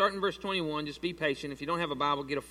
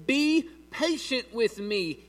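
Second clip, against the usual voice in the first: slow, higher plea, mildly performed; begging; 5 semitones higher, 4 dB louder, much slower than usual. A narrator's reading. Servant pleading with his master for time, begging. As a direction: read it as a drawn-out plea. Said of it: "he begged".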